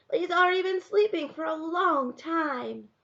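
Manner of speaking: put-on high, plaintive child's voice; small boy objecting, pitched well up; protesting little boy. A narrator's reading.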